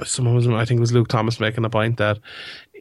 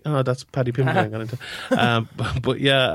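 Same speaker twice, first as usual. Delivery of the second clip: ordinary delivery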